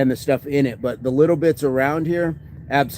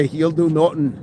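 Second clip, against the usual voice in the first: Conor McGregor. Acted. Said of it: "Imagine Conor McGregor".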